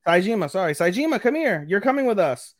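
high bossy summons; bossy call. Retold or imitated. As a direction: order him over, high and bossy. Komori ordering Saejima over.

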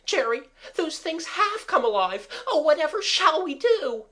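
high, loud and panicky; frightened pleading. A narrator's reading. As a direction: beg for help in fright. Mabel terrified, things came alive.

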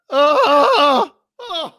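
high cries, slow gasp; shocked cry. Real, not acted. Ladder dive onto table.

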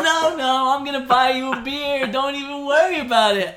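high, loud, pushy retold insistence; loud insisting. Retelling how he pressed the recovering alcoholic to take a beer, loud and high. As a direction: wave off his refusal, almost shouting with a laugh.

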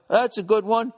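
a lightly voiced guy proud of his joke; mildly hammed-up gloating; smug self-satisfied. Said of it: "Just sitting there laughing".

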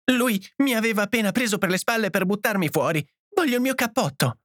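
high-pitched, plaintive voice; whining complaint. A narrator's reading. Edith complaining to John, whimpering. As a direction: whimper your grievance to your husband. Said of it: "piagnucolò la moglie".

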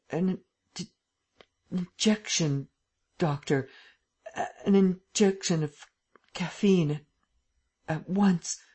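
halting, broken-off words; stammering. A narrator's reading. Drugged Lupin begging the doctor, stammering weakly. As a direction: stumble weakly over each word. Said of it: "Lupin stammered".

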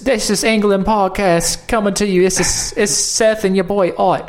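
high, loud hammed-up British accent; mock British radio intro; British podcast host. Introducing the podcast as Brits, hammed-up British. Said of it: "Oh, it's this".

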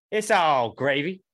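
usual speaking voice

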